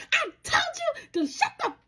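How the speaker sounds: very high, strained rant broken between words; hammed-up high-pitched fury; mock mad scolding. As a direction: act out a mama scolding mid-whipping. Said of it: "I am getting so mad".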